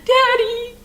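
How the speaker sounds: high, drawn-out mock wail; hammed-up tearful plea to Daddy; crying child, imitated. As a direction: whine it like a jealous little girl. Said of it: "the older sister started to cry".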